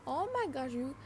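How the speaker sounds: normal talking voice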